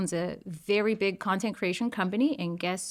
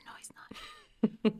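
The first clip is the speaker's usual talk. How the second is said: hushed, slow whisper; a slow secret whisper; 8 dB quieter, much slower than usual. Retold or imitated. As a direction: breathe it out slowly, voiceless. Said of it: "I said".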